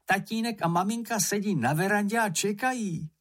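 high, urgent, excited urging; Mumínek calling out. A narrator's reading. Moomin urges friends toward waiting parents, calling excitedly. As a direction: hurry your friends along in a high voice. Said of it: "volal Mumínek".

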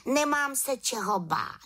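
high, confident little witch; little witch exclaiming. Acted. Little witch answers aunt's accusation, high exclaiming voice. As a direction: protest innocence in a high small voice. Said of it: "vykřikla malá čarodějnice".

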